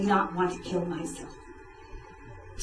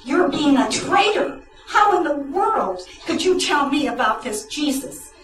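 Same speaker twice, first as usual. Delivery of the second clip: higher, a bit louder mock yell at a friend; retold yelling; 6 semitones higher, 3 dB louder than usual. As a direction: scold her as a traitor, half-acted. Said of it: "and I had yelled at her".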